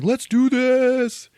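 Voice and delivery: very high, slow, loud stretched hype call; loud, slow hammed-up DJ shout. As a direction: drag it out like a party DJ. DJ hyping up party.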